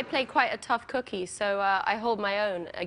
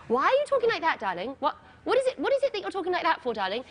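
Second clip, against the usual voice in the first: high, quick mum voice; imitating mum; 5 semitones higher, much faster than usual. Mum questioning her daughter's accent on the phone, imitated high voice. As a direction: jump up high as a puzzled mother. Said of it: "what's up, Mom".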